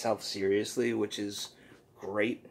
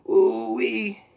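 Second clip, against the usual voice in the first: much higher, louder cartoon ooh-wee; high goofy ooh-wee; Poopy Butthole catchphrase; 12 semitones higher, 9 dB louder than usual. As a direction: squeal the catchphrase. Catchphrase of the creature.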